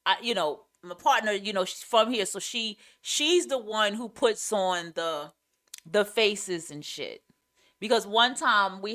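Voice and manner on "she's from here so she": ordinary delivery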